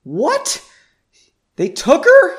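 high, loud, shocked squeak; almost squeak it in disbelief. A narrator's reading. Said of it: "he squeaked".

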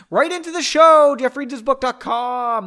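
high, loud announcer voice; announcer plug. Acted. Host plugging the show email, booming announcer plug. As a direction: sell it like a radio jingle.

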